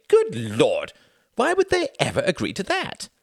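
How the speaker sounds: incredulous outburst. Acted. Sam incredulous at the proposed deal. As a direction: exclaim in disbelief.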